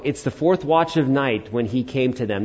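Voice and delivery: normal talking voice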